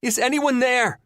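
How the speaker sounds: high, loud, frightened call; shout, strong but terrified; trapped woman calls out, afraid no one is there. A narrator's reading. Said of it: "both strong and terrified".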